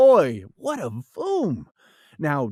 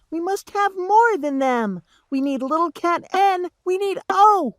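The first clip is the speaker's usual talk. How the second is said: much higher tiny cat voice; little cat calling out; 10 semitones higher than usual. Acted. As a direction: rattle off the cats like a little kitten. Little cats listing.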